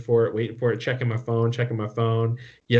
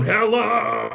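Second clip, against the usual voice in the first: yelling into the phone; 10 semitones higher than usual. Retold or imitated. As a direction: shout it up high to get someone to answer. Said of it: "yelling in the phone".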